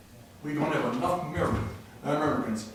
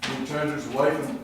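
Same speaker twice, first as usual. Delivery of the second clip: ordinary delivery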